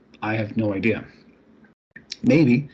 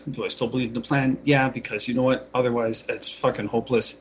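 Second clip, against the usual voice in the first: normal talking voice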